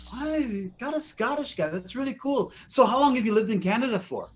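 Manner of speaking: high-pitched, chatty little guy; little casting guy. Retold or imitated. Casting guy quizzing a Scottish actor, high mock voice. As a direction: pipe up like an impressed little man. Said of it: "the little fucking guy goes".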